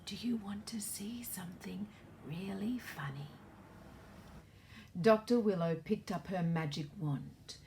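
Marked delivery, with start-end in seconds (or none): 0.1-3.3 a hushed secret whisper, much softer; gentle whisper to a sad child. Acted. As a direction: lean in and whisper the question. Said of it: "she whispered very softly".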